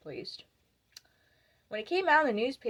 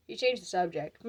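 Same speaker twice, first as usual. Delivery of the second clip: ordinary talk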